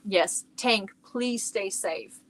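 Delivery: ordinary talk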